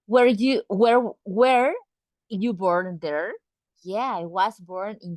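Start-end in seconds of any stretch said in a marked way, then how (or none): none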